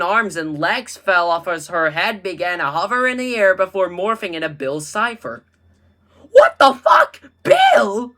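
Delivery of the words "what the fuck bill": very high, loud screaming; terrified scream. Acted. Drew screaming as Bill Cipher appears. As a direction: scream in panic. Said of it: "Drew screamed".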